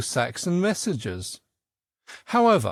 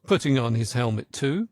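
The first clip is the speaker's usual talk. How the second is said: ordinary delivery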